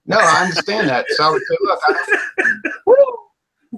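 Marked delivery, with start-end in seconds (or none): 1.6-3.8 can't keep a straight face, laugh cracks the line; breaking into laughter. Real, not acted. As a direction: voice squeaks up into a laugh mid-quote. Mock quote cracking into laughter.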